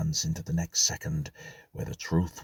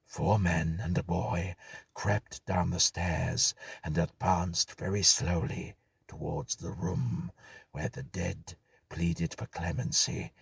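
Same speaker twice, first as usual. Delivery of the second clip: usual speaking voice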